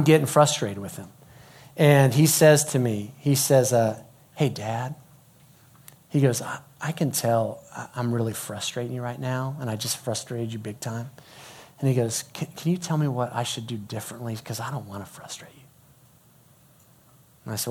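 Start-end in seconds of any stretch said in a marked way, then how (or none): 4.3-5.0 soft, lowered retold son's voice; careful approach. Son approaching his frustrated dad, careful approach. 6.4-11.1 soft, low, tentative retold son; gentle owning up. Son admitting he frustrated his dad, gentle owning up. 12.3-15.6 soft, hesitant retold son; humble asking. Son asking dad what to do differently, humble asking.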